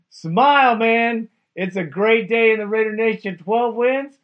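rally a gloomy fan with real excitement; rousing cheer-up. Real, not acted. Host telling a disappointed caller to smile, rousing.

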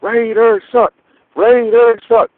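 high-pitched mock heckling chant; imitated crowd shouting. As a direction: mimic a jeering crowd, pitch jumped way up. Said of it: "they were just all shouting around me".

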